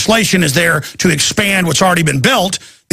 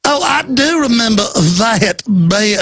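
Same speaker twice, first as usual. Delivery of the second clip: slow, higher mock Georgia drawl; affected Georgia accent; 4 semitones higher, slower than usual. Host repeating his mockery of the official, affected Georgia accent. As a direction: do a fake Georgia accent, stretching each word. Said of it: "This affected fake Georgia accent".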